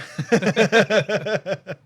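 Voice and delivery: big real burst of laughter; loud laugh. Real, not acted. Retelling the arrest, a loud real laugh. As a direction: let a high loud laugh go. Laughing at her own story.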